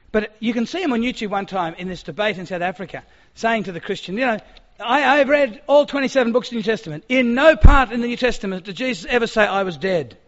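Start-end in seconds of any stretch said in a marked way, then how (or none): 4.2-10.2 loud, high, forceful debating challenge; confident debater. Retold or imitated. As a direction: work the crowd up with a confident claim. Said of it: "very powerful".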